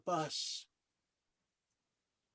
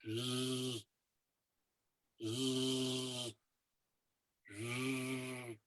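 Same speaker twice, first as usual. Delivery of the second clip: low, soft buzzing hum; valley hum; 4 semitones lower, 7 dB quieter, much slower than usual. Retold or imitated. Describing the Very Large Array valley, a soft low buzzing hum. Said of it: "this whole valley hums".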